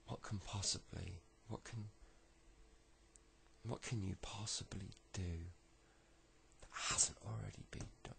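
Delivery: soft, half-whispered musing; inner thought. Acted. Little kid thinking to himself, hushed inner thought. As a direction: think aloud under your breath. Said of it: "he thinks to himself".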